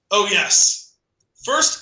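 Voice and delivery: usual speaking voice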